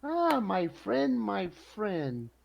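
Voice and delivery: theatrical high-pitched welcome; effusive friendly greeting. Acted. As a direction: greet an old friend with big swooping vowels. Merchant's customer Run greets him effusively.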